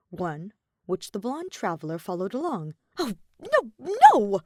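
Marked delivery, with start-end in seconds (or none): 3.0-4.4 high, quick flustered protest; panicked queen. A narrator's reading. Queen stopping the bow, high and flustered. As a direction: wave off the bow in alarm. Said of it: "The queen panicked".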